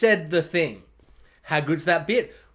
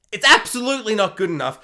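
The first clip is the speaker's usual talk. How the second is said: ordinary talk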